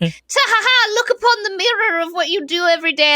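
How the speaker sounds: high, theatrical gloating cackle; mock villain reveal. Siona unveiling the mirror to the mages, high gloating cackle. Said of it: "And then she's like".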